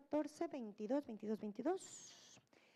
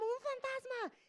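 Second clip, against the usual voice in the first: high frightened yelp of a ghost sighting; hammed-up terrified disciples; scared shriek; 11 semitones higher, slower than usual. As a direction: cry out like the boat is haunted. Disciples panic, repeated.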